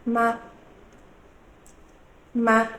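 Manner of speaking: short, light, unstressed "ma"; toneless, clipped-short Mandarin particle "ma"; neutral tone. Shown as a demonstration. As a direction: say "ma" briefly on one even pitch, no glide. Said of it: "We call it the neutral tone".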